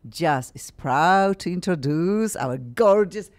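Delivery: loud, drawn-out announcer delivery; show host introduction. Acted. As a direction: present the guest with big stretched-out fanfare.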